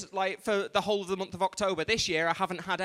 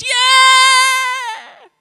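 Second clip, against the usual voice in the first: a high, loud mock crowd whoop of delight; audience cheering; 15 dB louder than usual. Comic mimicking the audience roaring as her name is announced. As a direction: whoop like a crowd greeting a star. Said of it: "the audience goes".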